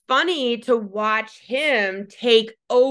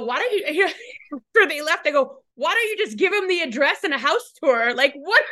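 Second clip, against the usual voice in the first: high, teasing exasperated jab, laughing; mock scolding; 8 semitones higher than usual. Wife teasing husband after the visit, mock exasperated. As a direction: playfully chew him out.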